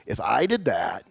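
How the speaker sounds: a touch of low, wry grumbling; wry onlooker. Acted. Dog owner watching a toddler with the dog, wry. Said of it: "and you look and say".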